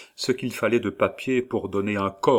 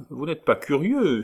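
high, loud motherly landlady voice; kindly landlady; 7 semitones higher, 3 dB louder than usual. Acted. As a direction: chirp it like a bustling landlady.

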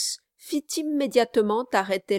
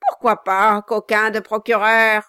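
loud mocking insult; jeering dwarf; 2 semitones higher, 9 dB louder than usual. Quilp mocks Brass the lawyer, loud jeer. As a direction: needle the lawyer.

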